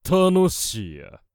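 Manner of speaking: high, loud, slow triumphant cry; booming commanding warlord. Acted. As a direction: bellow your delight to the battlefield. Wizard savours the battle.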